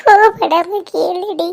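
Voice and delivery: slow, sobbing confession; crying. Acted. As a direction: drag the words out through sobs. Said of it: "எதுக்குடி அழுகுற?". (விம்மி அழுதபடி மெதுவாக)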